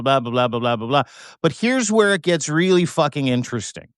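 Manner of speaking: usual speaking voice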